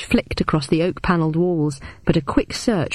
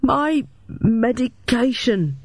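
stammering; 6 semitones higher, much slower than usual. A narrator's reading. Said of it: "then stammered".

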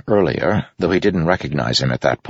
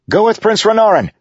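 high, loud order to soldiers; yelled; 6 dB louder than usual. A narrator's reading. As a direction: yell urgently at your men. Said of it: "he yelled at his men".

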